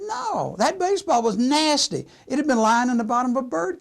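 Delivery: high, emphatic refusal; acted disgust. Mutt telling Pop about the dirty baseball, indignant disgust. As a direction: refuse loudly and high, as if revolted.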